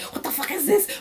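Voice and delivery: high mock outraged question; pissed off. Colleague with the weird hair reading the memo, high mock outrage. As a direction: jump way up in pitch, indignant. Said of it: "pissed off about it".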